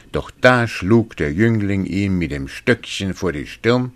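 plain reading voice

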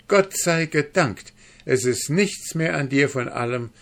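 high-pitched cry of relief; joyful exclamation. A narrator's reading. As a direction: call out thanks, high and loud, then easing. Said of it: "rief sie".

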